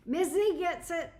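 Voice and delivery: bright excited agreement; emphatic praise. Real, not acted. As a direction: cheer her on with real enthusiasm. Emphatic repeat to the viewer.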